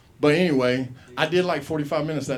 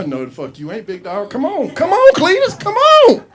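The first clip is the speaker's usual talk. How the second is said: egging him on; 13 semitones higher, 12 dB louder than usual. Pretend. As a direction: hype the big guy up. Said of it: "I'm not gonna be like".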